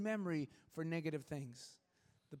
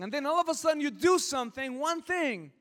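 rising emphatic; 8 semitones higher, 8 dB louder than usual. Real, not acted. Building point, loud high.

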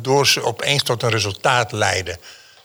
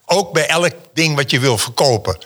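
ordinary delivery

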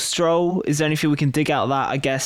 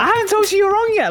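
excited; 4 dB louder, faster than usual. Real, not acted. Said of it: "Calm down".

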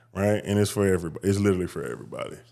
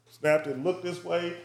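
ordinary talk

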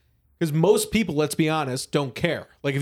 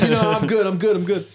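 laughing refusal; 3 dB louder than usual. Retold or imitated. Jake Paul refusing gin.

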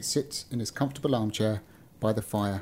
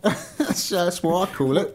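amused, higher Cockney voice; Cockney accent; 6 semitones higher, 4 dB louder, slower than usual. Pretend. Beggar defending his word for restaurant, laughing comic Cockney. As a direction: play the Cockney through a laugh. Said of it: "stick with him being a Cockney".

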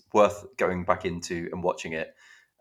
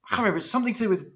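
higher, puzzled voice groping for a title; confused Netflix viewer; 10 semitones higher than usual. Retold or imitated. Podcast host mocks a confused viewer searching Netflix, high puzzled voice. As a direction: play someone who can't recall the name. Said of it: "can you imagine someone going into Netflix and going".

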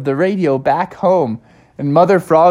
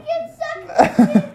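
high burst of giggles; real, short laugh. Real, not acted. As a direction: break up for a moment. Storyteller laughs mid-story.

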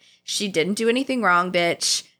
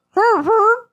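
much higher, louder mock owl hoots; 14 semitones higher, 7 dB louder than usual. Hedwig hooting at Harry, high and loud. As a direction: hoot like a sulky owl. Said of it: "he was like".

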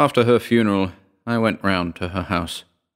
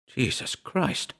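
soft, slow breathed exclamation; quietly breathed in dread; breathed oath; 5 semitones higher, 7 dB quieter, slower than usual. A narrator's reading. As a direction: let it out slowly on a hushed breath. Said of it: "I breathed".

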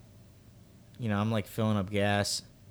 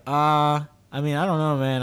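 reluctant; 5 semitones higher, 6 dB louder than usual. Retold or imitated. Reluctant reply to a stranger's request.

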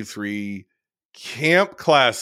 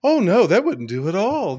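Aunt Martha voice; 9 semitones higher than usual. Retold or imitated. Imitating Aunt Martha character.

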